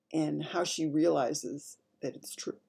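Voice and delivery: ordinary delivery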